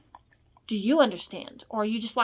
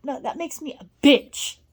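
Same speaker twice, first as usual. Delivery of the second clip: usual speaking voice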